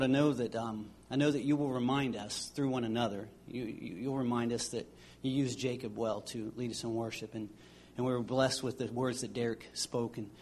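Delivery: low, subdued praying voice; prayer. Real, not acted. Pastor praying to God for the congregation, low, soft prayer. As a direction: pray softly and earnestly, pitched low.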